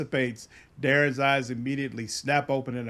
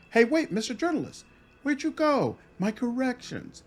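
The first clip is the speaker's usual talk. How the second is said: high, flustered; 8 semitones higher than usual. Acted. Vision vanishes mid interview.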